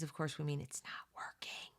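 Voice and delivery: a whispered confidential aside. Real, not acted. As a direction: lean in and whisper it. Aside explaining the book's word.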